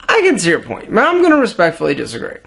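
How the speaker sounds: low, slurred mumble; mumbling. Shown as a demonstration. Showing viewers what mumbling sounds like, low mumble. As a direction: swallow the words, mumbled. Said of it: "you might sound like this".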